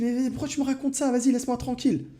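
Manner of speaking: high-pitched brother brushing him off; annoyed little brother. Retold or imitated. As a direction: play the brother fed up and pushing him away.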